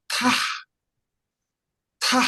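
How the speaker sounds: Ta said high and short, then repeated; syllable drill. Shown as a demonstration. Teacher to class, modelling the sound. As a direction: model Ta crisply for students. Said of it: "I will read them one by one".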